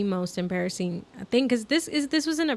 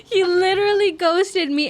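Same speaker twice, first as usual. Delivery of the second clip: higher, louder laughing cry of dismay; exasperated outburst; 9 semitones higher, 5 dB louder than usual. Real, not acted. Telling friend she was ghosted, in a high, loud exasperated cry. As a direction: let the embarrassment burst out.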